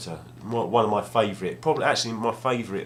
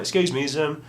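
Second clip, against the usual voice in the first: timid asking; 6 semitones higher than usual. Retold or imitated. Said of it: "And I went".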